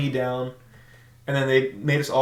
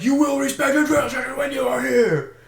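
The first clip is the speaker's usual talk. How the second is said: drill instructor shout; 11 semitones higher than usual. Retold or imitated.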